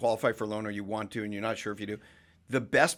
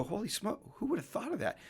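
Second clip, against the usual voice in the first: amazed; 10 semitones higher, 6 dB quieter than usual. Retold or imitated. Retold amazed reaction.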